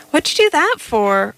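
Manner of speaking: sharp, indignant question; indignant demand. A narrator's reading. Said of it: "Roll Roll demanded".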